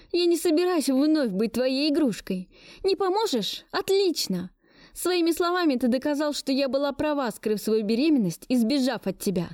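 high, indignant retort. Acted. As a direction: throw it back at him. Heroine's furious retort.

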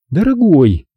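high, drawn-out mild lament; woeful sigh. A narrator's reading. Zelda laments over her ex-husband, mildly woeful sigh. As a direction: sigh the word with a touch of sorrow. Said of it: "горестно вздохнула Зельда".

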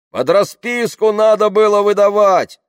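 high, loud shout of reproach. A narrator's reading. Heckler behind the crowd blaming Tamara, shouted. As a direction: yell it from the back of a crowd. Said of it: "крикнули сзади".

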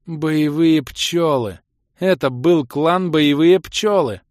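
high, loud, slow despairing cry; anguished moan. A narrator's reading. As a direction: wail it out in despair. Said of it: "простонала Кира".

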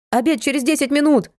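high call across the flat; shouting. A narrator's reading. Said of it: "крикнула Натка". (громкий крик)

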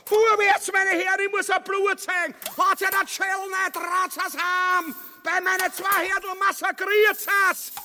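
sehr hoch und laut schreiend; panisch-kampfeslustig. Acted. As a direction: im Getümmel hysterisch anfeuern. Ubu im Kampfgetümmel. (hysterisches Gebrüll, viel höher und lauter)

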